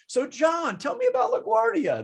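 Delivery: very high, sing-out friendly opener; chatty waiter greeting. Retold or imitated. Waiter greets customer by shirt name, exaggerated chattiness.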